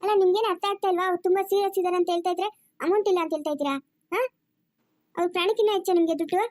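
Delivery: exasperated telling-off, pressing them to hurry; indignant scolding. Acted. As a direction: berate the relatives for stalling. Nurse berates family without money.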